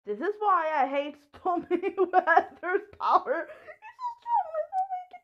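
loud, high-pitched exasperated whine; exasperated outburst. Real, not acted. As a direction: rant at the game, voice shooting up high. Game power frustrates her mid-play.

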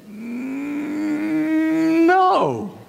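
high, drawn-out balking no; a squeaky stubborn refusal; refusing Moses. Acted. Moses balks at God's order with a high whiny no.

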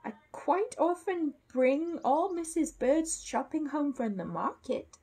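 high, slow, earnest bear; politely proud little bear, drawn out; Paddington. Acted. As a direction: offer slow, high-pitched reassurance. Paddington answers, in character.

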